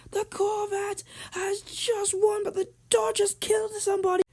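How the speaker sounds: very high-pitched play-by-play voice; frantic race commentator. Acted. Commentator calling the final race live, frantic high voice. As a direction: call every turn in a high, urgent commentator voice.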